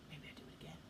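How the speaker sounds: very soft whispered thought; scheming whisper. Retold or imitated. Bored boy plotting to trick mom, whispering. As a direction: whisper it as a sly idea to oneself.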